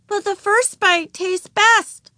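put-on high, slow child voice explaining; reasonable little girl. A narrator's reading. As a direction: explain patiently like a small child. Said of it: "explained Ramona reasonably".

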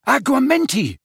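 high, loud spell shout. A narrator's reading. Harry shouting a spell at the goblet. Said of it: "he shouted".

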